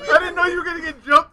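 loud, high protest breaking into laughter; laughing mock apology. Storyteller apologizing to jumped friend Flores, loud laughing protest. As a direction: plead innocence through laughter.

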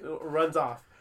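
usual speaking voice

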